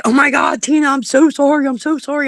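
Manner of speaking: mock-frantic friend apologizing to Tina; girlfriend's frantic apology. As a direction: play the girlfriend sorry and flustered. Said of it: "she's like".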